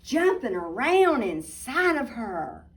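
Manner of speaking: put-on lively storybook narration for kids; reading to children. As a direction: tell it like a bedtime picture book. Reading picture book aloud.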